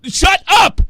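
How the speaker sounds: yelling at the caller; shouted shut up. Real, not acted. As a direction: bark it to silence him.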